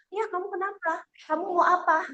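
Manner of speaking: a hint of a doting mother fussing over her child; fussing parent. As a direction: coo over the child in a rush.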